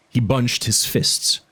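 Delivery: plain reading voice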